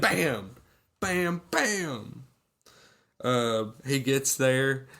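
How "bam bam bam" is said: higher, spaced-out "bam" sound effects; imitated punch noises. Retelling the airport fight, comic punch sound effects. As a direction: punctuate each imaginary blow.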